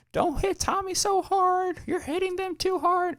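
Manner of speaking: high-pitched slow mocking mom voice; squeaky scolding mom, dragged out; mom's high voice. Neighbor mom yelling at kids.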